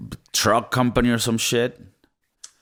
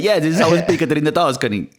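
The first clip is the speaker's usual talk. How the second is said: higher-pitched hammed-up Tuscan accent; playful put-on Tuscan accent; mock Tuscan accent; 6 semitones higher, 3 dB louder than usual. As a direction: imitate her Tuscan English. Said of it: "she literally speaks like that".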